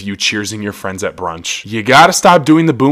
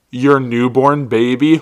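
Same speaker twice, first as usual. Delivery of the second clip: normal talking voice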